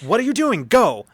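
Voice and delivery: high, loud shout of disbelief; furious captain yelling at security; shouted demand. Acted. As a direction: yell it at the guards. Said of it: "she's like".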